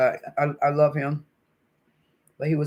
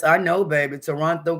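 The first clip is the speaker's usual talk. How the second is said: usual speaking voice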